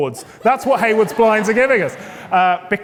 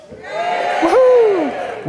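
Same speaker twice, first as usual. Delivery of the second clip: excited whoop; 14 semitones higher than usual. Real, not acted. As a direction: whoop to fire up the room. Host hyping the awards crowd.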